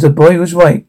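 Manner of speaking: plain reading voice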